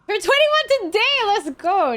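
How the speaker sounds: high, loud party cheer; excited cheering. Pretend. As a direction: hype it up like a party. Mock birthday celebration.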